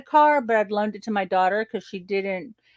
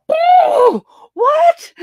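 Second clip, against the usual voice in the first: much higher and louder, a stunned cry; shocked outburst; 12 dB louder than usual. Retold or imitated. Hearing her friend will pay the tenant, a stunned yelp. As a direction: yelp it out in disbelief.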